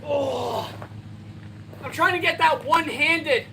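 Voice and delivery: strained effort voice; words pushed out mid-effort; straining. Real, not acted. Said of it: "but it's so hard".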